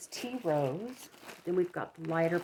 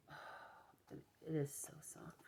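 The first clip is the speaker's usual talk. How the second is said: whispering; 13 dB quieter, slower than usual. Real, not acted. Admiring yarn softly.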